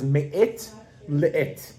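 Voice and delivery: "me'et le'et" in modern Hebrew, T sounds at the ends; modern Hebrew accent. Shown as a demonstration. Teacher showing the Hebrew source, modern Hebrew accent. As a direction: Israeli Hebrew accent, each word in two syllables. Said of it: "pronounce it first with a modern Hebrew accent".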